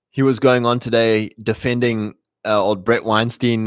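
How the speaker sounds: ordinary talk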